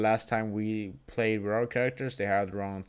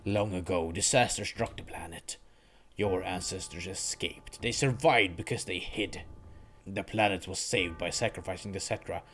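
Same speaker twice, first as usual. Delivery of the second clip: low, soft, coldly superior villain; cold Sephiroth voice; 4 semitones lower, 5 dB quieter than usual. Acted. Villain explaining the planet's history, low and cold. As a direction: lecture him with icy contempt.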